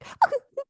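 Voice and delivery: high-pitched fake sob; mock crying. Woman imitating a viral sound, mock crying. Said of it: "It was like".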